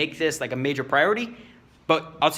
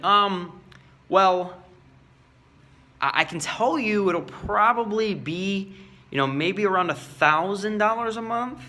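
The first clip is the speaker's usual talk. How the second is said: hesitant, higher and slow price mumble; unsure; 5 semitones higher, slower than usual. Shown as a demonstration. Salesman quoting price to client, unsure. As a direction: hedge the number like you doubt it. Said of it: "I won't be like".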